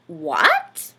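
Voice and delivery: tiny mouse shocked; squeaky mouse surprise. A narrator's reading. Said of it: "squeaked Mortimer".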